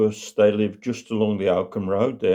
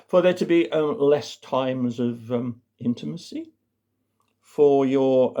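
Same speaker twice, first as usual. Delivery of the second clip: higher, plummy voice with delicate hesitations; posh doctor; 5 semitones higher than usual. Acted. Doctor explaining waning intimacy to couple in their eighties, posh and tactful. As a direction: pick your words delicately in a put-on posh voice. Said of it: "in a posh voice".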